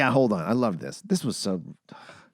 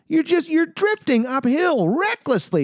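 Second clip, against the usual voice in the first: incredulous. Retold or imitated. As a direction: rise way up in mock disbelief. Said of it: "I'm thinking".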